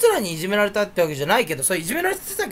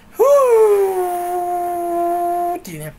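long high blown breath; breathy whistle attempt; 9 semitones higher, 4 dB louder, much slower than usual. Shown as a demonstration. Showing how his whistle fails, long blown breath. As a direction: blow like trying to whistle.

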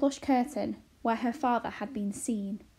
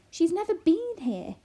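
high, loud, bewildered protest; agitated, swooping disbelief; exclaiming; 8 semitones higher, 6 dB louder than usual. A narrator's reading. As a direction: insist in dismay. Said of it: "exclaimed Arrietty".

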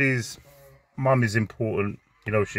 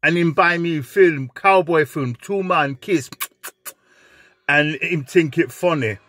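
lightly pitched-up patois; aggrieved patois complaint; Jamaican accent; 3 semitones higher than usual. Retold or imitated. The flatmate finishing his complaint to the dad, lightly raised patois. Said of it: "flatmate continues".

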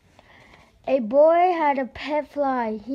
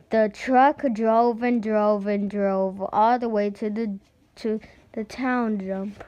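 ordinary delivery, nothing marked

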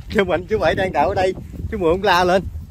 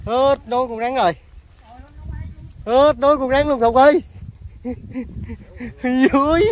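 thrilled exclamation; 6 semitones higher than usual. Real, not acted. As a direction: call out in real excitement at the catch. Thrilled at the huge snake. (reo lên phấn khích, to và cao)

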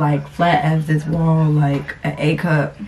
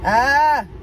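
a sudden loud, high cry of pain; pained yelp; 8 semitones higher, 5 dB louder than usual. Real, not acted. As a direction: yelp as the pinch hits.

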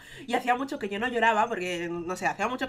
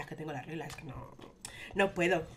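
low, soft inner voice; 5 semitones lower, 6 dB quieter than usual. Retold or imitated. Her own thoughts in a live stream, said low and soft. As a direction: say it quietly, as if to herself. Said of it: "yo en mi cabeza".

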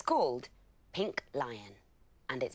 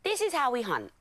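lions showing off; 10 semitones higher, 6 dB louder than usual. A narrator's reading. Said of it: "said the lions".

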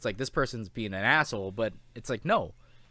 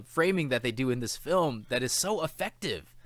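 ordinary talk

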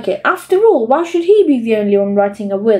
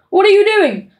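startled dad; 8 semitones higher than usual. A narrator's reading. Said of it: "said Dad, staring".

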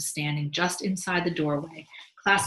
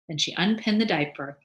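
ordinary delivery

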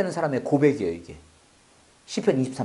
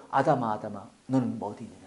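soft, searching call to Adam; gentle calling; 9 semitones lower, 8 dB quieter than usual. Acted. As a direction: lower and softer, tender calling of Adam. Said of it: "안 했어요".

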